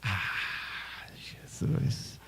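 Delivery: whispered gasp of fear; a frightened whisper before the fall; scared whisper. Acted. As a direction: breathe it out, too scared to speak. Woman frightened before falling.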